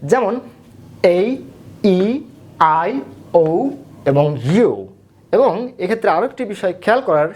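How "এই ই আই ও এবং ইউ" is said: এই, ই, আই, ও, ইউ as letter names, one by one; long vowel sounds. Shown as a demonstration. Teacher lists vowels for learners, long sounds spaced apart. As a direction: isolate each long vowel: ay, ee, eye, oh, you. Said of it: "উচ্চারিত হয় long sounds হিসাবে".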